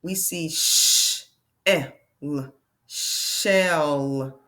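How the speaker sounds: slow sh, eh, l, then shell; teacher blending a word; sounding out. Shown as a demonstration. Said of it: "sounding out shell".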